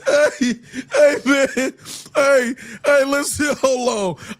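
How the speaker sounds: trying to regain control; laughing. Real, not acted. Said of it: "I'm fucking crying".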